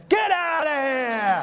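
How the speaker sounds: slow, stretched-out high yell; retold drawn-out shout. Driver yelling off a request for a kiss, slow stretched shout. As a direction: drag the words out in a loud, high holler.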